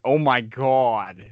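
drawn-out Yankee accent impression; exaggerated Northern accent. Teasing Northerners about how they talk, exaggerated Yankee accent. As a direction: stretch it in a mock Northern accent. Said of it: "it's so exaggerated with".

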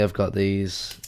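ordinary talk, nothing marked

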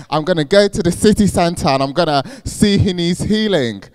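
fired-up resolve. Retold or imitated. Recalling her student self, fired up and eager. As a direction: announce the plan like nothing can stop you. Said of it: "I got so fired up".

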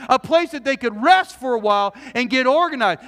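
ordinary talk, nothing marked

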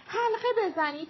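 first shout of «حلقه بزنید», high but not yet full volume; Mira shouting. A narrator's reading. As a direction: start the call to form a circle. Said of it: "میرا داد زد".

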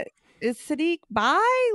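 high-pitched puzzled question; puzzled reaction. Retold or imitated. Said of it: "and I'm like".

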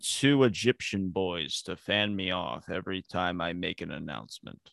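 lightly voiced grand pharaoh; Kamala impression. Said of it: "That was my Kamala impression".

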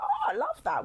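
high-pitched gush of delight; keen, high-pitched re-enacted reaction; excited. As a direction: swoop up high with enthusiasm. Reacts keenly to a business idea.

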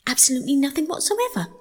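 high, quick mole voice; frank, matter-of-fact mole. A narrator's reading. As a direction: answer bluntly and in all honesty. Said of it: "replied the mole with perfect truthfulness".

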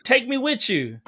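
pleading joke. Retold or imitated. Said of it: "I was like".

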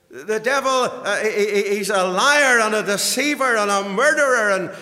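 high, loud pulpit denunciation; full-force preaching. Real, not acted. Preacher warning the congregation of the devil's lies, fervent denunciation. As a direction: denounce the enemy at full pitch.

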